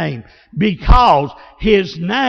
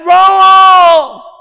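loud, high, drawn-out holler; hollered name; 11 semitones higher, 12 dB louder than usual. Retold or imitated. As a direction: holler for your brother to save you. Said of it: "I hollered".

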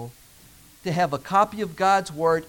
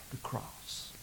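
whisper; 8 semitones lower, 20 dB quieter than usual. Acted. Hushed sermon moment.

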